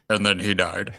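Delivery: normal talking voice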